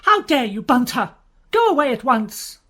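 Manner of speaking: outraged lady ordering him out; loud furious command. Acted. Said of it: "and furious".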